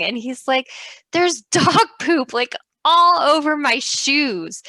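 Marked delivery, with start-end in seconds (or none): 1.1-4.6 husband's dismay, higher and louder, slow; imitating upset husband. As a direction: play the grossed-out husband, drawn out. Husband reporting dog poop.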